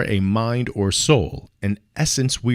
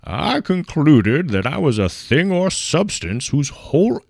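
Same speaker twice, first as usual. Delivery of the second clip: put-on old-timey voice; 2 semitones higher, 4 dB louder than usual. Lecturer reading a Descartes quote to students in a grand old-timey voice. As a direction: declaim it like a grand old gentleman. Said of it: "I'm gonna do it in my old-timey voice".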